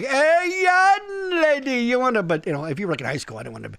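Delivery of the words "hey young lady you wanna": higher, slow sleazy come-on voice; sleazy flirt.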